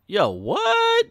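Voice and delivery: very high cartoon squeak of surprise; high squeaky cartoon dude; dopey dude voice. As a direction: squeak it out like a startled goof. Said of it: "He's like".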